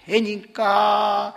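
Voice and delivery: ordinary talk, nothing marked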